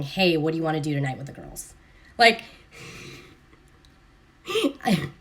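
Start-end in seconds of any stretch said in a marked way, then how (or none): 0.0-1.7 annoyed reading. Retold or imitated. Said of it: "She's going".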